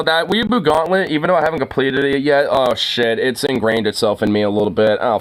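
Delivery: ordinary talk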